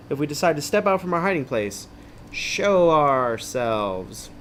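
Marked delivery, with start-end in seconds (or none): 2.3-4.3 very slow, stretched announcement; drawn out. Pretend. Host offering listeners the second choice, drawn out. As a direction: stretch each word for drama.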